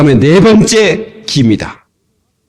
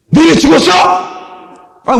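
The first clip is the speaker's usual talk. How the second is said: mock scolding; 13 semitones higher, much slower than usual. Preacher scolds mumbling pray-er.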